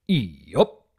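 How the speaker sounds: low, short farm-pony yup; terse low grunt of agreement; lightly voiced stoic stallion; Big Mac drawl. A narrator's reading.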